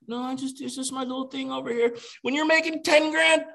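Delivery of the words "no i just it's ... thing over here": small, higher, softer voice playing it down; imitated timid self-minimizing; mock bashful hiding. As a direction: act sheepish, as if hiding a secret. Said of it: "we wanna hide that".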